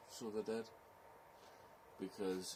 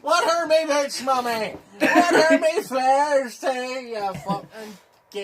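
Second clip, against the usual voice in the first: laughing shout; 22 dB louder, slower than usual. Acted. Laughing, hammed-up Belfast shouting.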